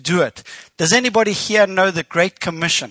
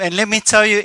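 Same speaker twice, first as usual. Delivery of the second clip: ordinary talk, nothing marked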